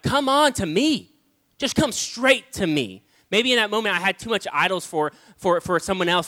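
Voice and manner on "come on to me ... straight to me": urgent invitation. Retold or imitated. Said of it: "God was like".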